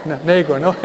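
ordinary talk

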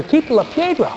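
mucho más agudo, insistiendo con fuerza; orden insistente. A narrator's reading. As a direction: repite la orden, más alto y tajante. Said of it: "Jesús insistió diciendo". (Jesús insiste ante la tumba: orden aguda y firme)

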